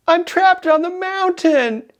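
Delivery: high, slow, drawn-out call with laughter; mock distance call, each word stretched. Stranded hiker calling down to boatman, mock calling out.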